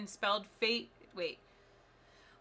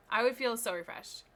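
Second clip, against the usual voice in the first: usual speaking voice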